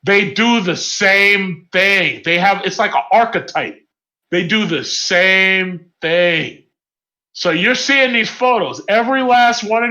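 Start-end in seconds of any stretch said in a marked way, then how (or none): 0.0-2.2 slowed right down for emphasis; slow emphatic. Real, not acted. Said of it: "Let me tell you something". 4.3-6.6 drawn-out and heavy; even slower emphatic. Real, not acted. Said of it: "Let me tell you something".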